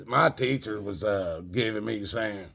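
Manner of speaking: ordinary talk, nothing marked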